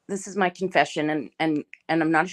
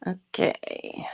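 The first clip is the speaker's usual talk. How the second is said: a soft whispered okay; 5 dB quieter than usual. Real, not acted. Streamer whispers to herself while searching. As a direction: breathe the word, no voice.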